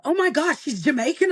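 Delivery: high-pitched amazed reaction; gushing, high mock astonishment; astonished fans. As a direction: mimic people making a surprised discovery. Viewers reacting to viral video.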